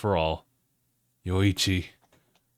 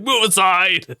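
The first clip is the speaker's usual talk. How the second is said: higher, loud mock shout; 10 semitones higher, 7 dB louder, slower than usual. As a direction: bark it out, pushing past. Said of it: "inside, he's like".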